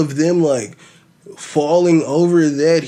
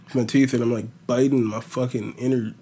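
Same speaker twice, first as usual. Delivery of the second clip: usual speaking voice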